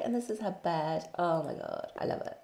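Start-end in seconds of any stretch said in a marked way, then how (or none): none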